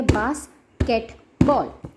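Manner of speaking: slow, basketball broken into clapped beats; clapped syllables. Shown as a demonstration. Showing parents how to clap out syllables, slow beats. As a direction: count out basketball slowly, syllable by syllable. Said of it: "जितनी भी clapping या tapping होती है".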